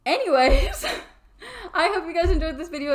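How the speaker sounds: high, loud, with a laugh; laughing outro. Real, not acted. Signing off to viewers after the dream, laughing. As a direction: wrap up brightly, laughing.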